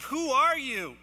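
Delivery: high, loud mock shout over a storm; acted yelling. Sailors confront Jonah on the bow in a storm, acted yelling. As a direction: call it out as if over wind and rain. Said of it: "they are yelling to one another".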